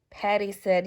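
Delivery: usual speaking voice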